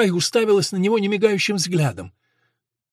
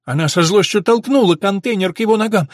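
usual speaking voice

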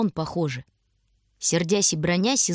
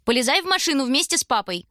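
bossy order; 7 semitones higher than usual. A narrator's reading. Строгая девушка командует.

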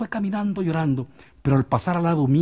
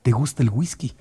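ordinary delivery, nothing marked

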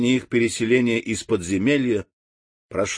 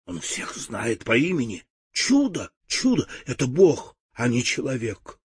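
взволнованно; 4 dB quieter than usual. Acted. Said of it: "взволнованные голоса".

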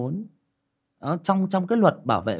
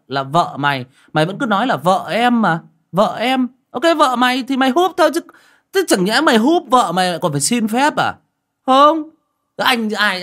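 high and loud, winding down at the end; big brother lecturing, worked up; heated lecture; 8 semitones higher, 8 dB louder than usual. Retold or imitated. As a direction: scold him loudly, then settle. Retelling his scolding reply.

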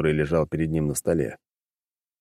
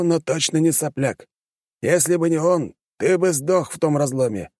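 put-on stern old man; 8 semitones higher than usual. A narrator's reading. Pushkin scolding Alekseev.

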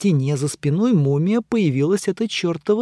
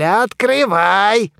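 angry; 6 semitones higher, 4 dB louder, much slower than usual. A narrator's reading. Second, angrier demand.

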